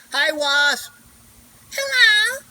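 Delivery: high, loud teasing sing-song; loud high mock greeting to a wasp; taunting wasp. Real, not acted.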